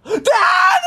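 shouted high call. Real, not acted. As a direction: yell for dad. Kid calling excitedly to dad.

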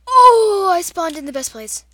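loud, high gasp of delight; surprised. Real, not acted. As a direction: yelp of surprise. Great spawn.

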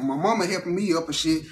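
usual speaking voice

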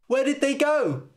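baffled shout; fairy cries out. Acted. A fairy cries out when Harold vanishes, high startled cry. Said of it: "cried one of the fairies".

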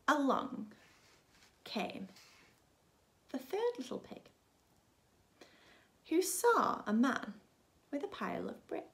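usual speaking voice

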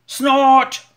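very loud snort in a strange voice; machine snort. Acted. The big digger answering the baby bird with a loud snort. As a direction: blast it out like a huge engine.

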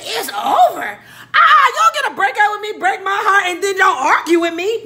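high, loud outraged yelling; shouting at boyfriend who dumped her. Retold or imitated. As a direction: blow up at him in disbelief. Retold heated argument.

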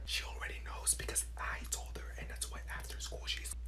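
hushed, voiceless chatter; whispered gossip. Acted. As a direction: whisper gossip to a classmate during class. Pupil keeps gossiping during class.

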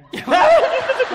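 high hearty laugh; sudden high-pitched laugh; loud burst of laughter. Acted. Son laughs at his father's joke.

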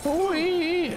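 sudden high yelp of surprise; high startled cry. Real, not acted. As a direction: yelp as if something jumped out.

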